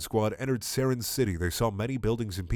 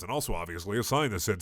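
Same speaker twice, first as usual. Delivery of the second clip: plain reading voice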